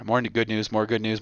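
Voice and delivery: ordinary talk, nothing marked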